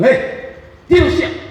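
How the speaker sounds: high, sharp acted command; mother's order. Mother orders young children to nap, high commanding call. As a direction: call the children to bed, pitched right up.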